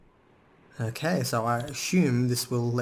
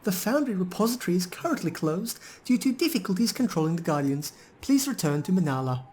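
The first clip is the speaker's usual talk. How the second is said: high, robotic recorded-announcement voice; golem's second voice; 6 semitones higher than usual. Acted. Player voicing the golem's second voice to the party. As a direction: read it up high like a stuck machine message. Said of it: "speaks again in a noticeably different voice".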